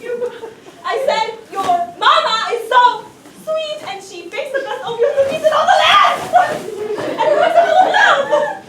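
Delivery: a mock New Jersey insult that turns sweet; New Jersey accent, hammed-up stern then giggling. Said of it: "stern New Jersey voice".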